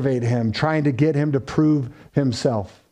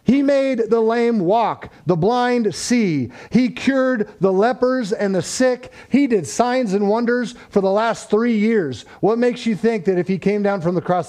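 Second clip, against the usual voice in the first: fervent preaching; 7 semitones higher than usual. Acted. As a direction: preach it to the back row. Preaching builds to a peak.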